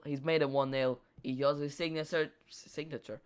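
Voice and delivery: usual speaking voice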